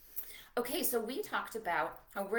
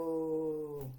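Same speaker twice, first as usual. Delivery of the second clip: long drawn-out low hello; very slow hello; 6 semitones lower than usual. Shown as a demonstration. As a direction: stretch it out as slow as molasses. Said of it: "hello as slow as we can".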